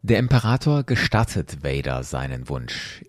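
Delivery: usual speaking voice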